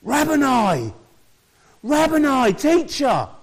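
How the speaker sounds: high, loud recognising cry; cried out. Acted. Mary crying out to Jesus at the tomb, high and loud. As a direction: call out to him in sudden recognition. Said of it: "cried out in Aramaic".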